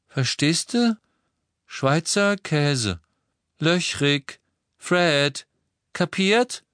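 high, word-by-word spelling out; pointed, separated words, rising at the end; explaining a joke. A narrator's reading. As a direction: spell it out slowly for a slow brother. George spells out his joke.

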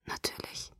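hushed hopeless whisper. Acted. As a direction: let it fall out on a breath. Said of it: "flüsterte ich".

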